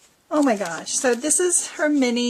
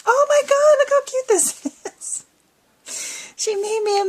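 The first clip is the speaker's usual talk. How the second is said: much higher and louder, bursting with glee; delighted squeal; 11 semitones higher, 4 dB louder than usual. Real, not acted. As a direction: squeal at how cute it is. Thrilled at mini bag.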